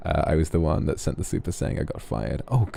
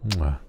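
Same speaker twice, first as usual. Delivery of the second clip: short "mwah" kiss sound; blown kiss. Pretend. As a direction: smack a quick kiss to a listener. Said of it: "then give me a kiss".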